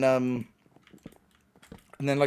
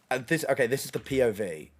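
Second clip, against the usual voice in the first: ordinary talk, nothing marked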